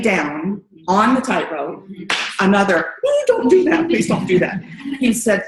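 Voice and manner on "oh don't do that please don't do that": high, loud startled plea; mock alarm. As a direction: beg playfully as if scared. Walker lies down on tightrope.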